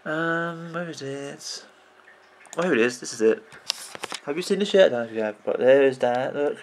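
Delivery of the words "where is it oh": usual speaking voice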